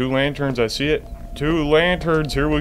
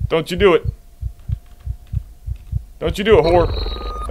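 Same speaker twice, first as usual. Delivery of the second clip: warning threat; 6 semitones higher than usual. Real, not acted. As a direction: warn the monster off. Threatening monster.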